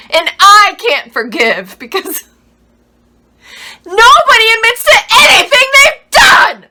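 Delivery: loud, high fury building to a scream; furious, tearful outburst at the top of the voice; anguished accusation. Acted. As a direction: build from pained reproach to a full shout.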